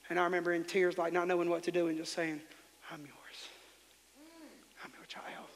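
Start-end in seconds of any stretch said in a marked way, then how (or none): 2.9-5.5 unvoiced whispered prayer; tearful schoolboy's whispered prayer. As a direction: whisper it as a hurting child. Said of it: "whispering under my".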